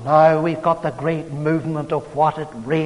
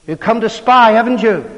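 rough accusing; 7 semitones higher, 7 dB louder than usual. Acted. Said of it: "spoke roughly to them".

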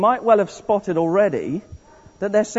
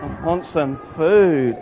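slow, drawn-out whine, lower; hungry whine; 4 semitones lower, much slower than usual. Shown as a demonstration. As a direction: drag it out like a starving moaner. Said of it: "So let's do that".

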